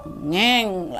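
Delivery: long drawn-out engine whine; imitated motorbike noise. Storyteller mimicking bikes setting off, drawn-out engine hum. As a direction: stretch it out like a motorbike pulling away.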